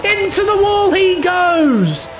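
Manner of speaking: rising excited call of a crash; excited commentary. Real, not acted. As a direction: shout the crash as it happens. Crash during live race commentary.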